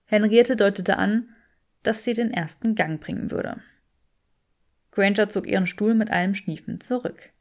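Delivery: usual speaking voice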